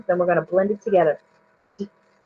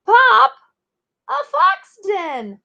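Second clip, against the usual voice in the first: yelled discovery; 12 semitones higher, slower than usual. A narrator's reading. Val yelling to Pop about the fox den, high and excited. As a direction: shout out the find to Pop. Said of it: "yells Val".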